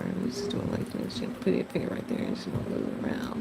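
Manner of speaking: hushed whisper to herself; quick unvoiced whisper while shading. Real, not acted. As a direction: whisper it as if nobody should hear. Soft aside while drawing.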